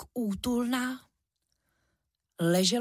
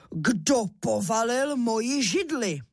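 slow, higher-pitched papa bear shout; strong bear roar; 4 semitones higher than usual. A narrator's reading. Papa bear Ivan finds his chair knocked over, bellowing. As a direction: bellow it slowly as the big father bear. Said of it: "rozkřičel svým silným medvědím hlasem".

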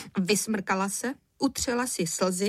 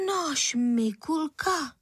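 slow, high, stunned question; shocked mother, pitched up; taken aback; 6 semitones higher, much slower than usual. A narrator's reading. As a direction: stop short in disbelief, stretching the name. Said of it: "zarazila se paní Středníková".

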